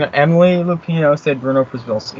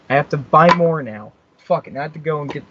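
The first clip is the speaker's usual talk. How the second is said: ordinary delivery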